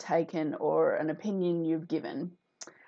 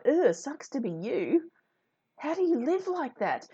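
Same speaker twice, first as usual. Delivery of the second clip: higher, disgusted "Ugh" into pitying disbelief; mock pity; 8 semitones higher than usual. Thinking about people who attack, mock pity. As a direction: pity them with exaggerated distaste.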